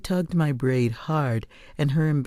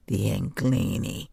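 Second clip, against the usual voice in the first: venomous; 6 semitones lower than usual. Acted. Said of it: "She spat out the invaders' names with such venom".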